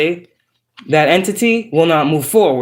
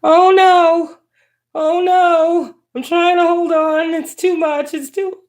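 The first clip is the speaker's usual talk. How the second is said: very high, squealing mock panic; mock overwhelmed; 12 semitones higher, slower than usual. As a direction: squeal like it's all too much. Playful overwhelm at the cards.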